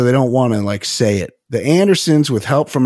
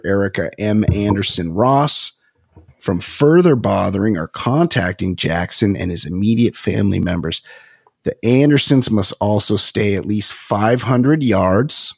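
ordinary talk, nothing marked

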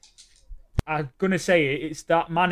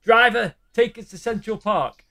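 raised-pitch taxi passenger; passenger to driver; 5 semitones higher, 3 dB louder than usual. Retold or imitated. Passenger tells the taxi driver where to go, mildly acted order. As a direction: call your order up to the driver. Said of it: "they get that taxi and he's like".

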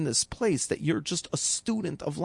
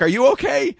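astonished; 9 semitones higher, 9 dB louder than usual. Retold or imitated. Wife asks guy, astonished.

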